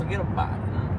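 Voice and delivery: lightly put-on New York accent; a hint of a New York wiseguy. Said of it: "New York accent".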